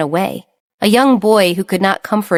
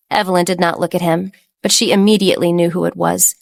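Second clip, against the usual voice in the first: ordinary talk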